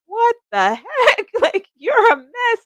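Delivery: high, slow mock disbelief; shocked viewer. Viewer meeting her, mock shocked at her mess. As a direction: play a stunned friend seeing her mess. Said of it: "you'd be like".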